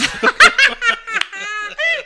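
high, loud real laughter; high burst of laughter. Real, not acted. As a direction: burst out laughing mid-story. Laughing at own funny story.

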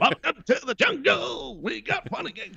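a hint of [name] shouting. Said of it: "[name] doing the song".

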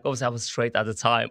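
ordinary delivery

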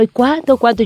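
mildly eager husband voice; eager question. Retold or imitated. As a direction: push for the number, impatient. Husband eager asking amount.

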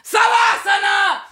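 high, drawn-out yell; imitated relentless teacher; shouting instructor. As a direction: interrupt with a stretched, commanding shout. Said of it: "me grita como".

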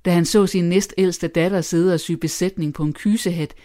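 ordinary delivery

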